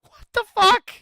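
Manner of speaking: high loud outburst; outraged. Real, not acted. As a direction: blurt it in disbelief. Outraged exclamation.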